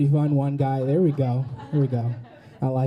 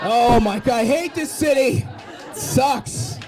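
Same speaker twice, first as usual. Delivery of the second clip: hammed-up fed-up wail; exasperated outburst; 5 semitones higher, slower than usual. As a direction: cry out in mock despair, pitched up. Mock outburst at city after punchline.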